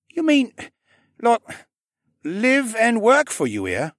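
put-on high, slow disbelieving boy; slow, unsure. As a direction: ask it slowly in disbelief. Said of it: "Viken asked slowly".

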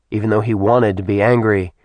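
ordinary delivery

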